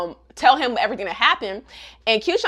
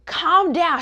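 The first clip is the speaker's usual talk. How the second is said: higher-pitched mock plea; imploring calm-down; 6 semitones higher than usual. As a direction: act out a friend urging calm. Acted Agatha soothing Hana.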